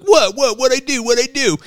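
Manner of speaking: high, loud squeal of mock innocence; panicked protest. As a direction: squeak it out like a kid caught red-handed. Caddy protests innocence, retold story.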